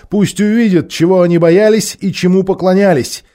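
high, ringing, loud declaration; full voice, no whispering. A narrator's reading. Тирион rallying his friends to meet everyone, out loud. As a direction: proclaim it boldly, voice lifted. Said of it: "заговорил уже не шёпотом".

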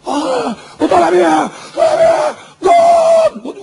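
high, screamed football commentary; shouting match commentator. Acted. Mimicking a commentator as a goal comes, screaming high. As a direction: yell the attack up to a goal, pitch soaring. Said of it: "ويزعق ويصرخ".

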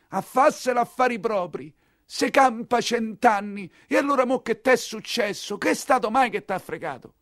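high mock voice quoting familiar advice; quoting an old saying. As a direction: parrot the old warning back, pitched high. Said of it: "ma hai sempre detto".

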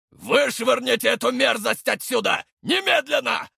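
high shouted command; steely shouted order. A narrator's reading. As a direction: bark the order at the guards. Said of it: "стальным тоном приказал". (стальной приказ криком)

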